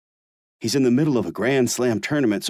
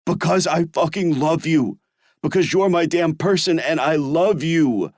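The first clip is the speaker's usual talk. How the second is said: louder, higher passionate outburst; erupting; 8 semitones higher, 4 dB louder than usual. Acted. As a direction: burst out with love. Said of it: "I erupt".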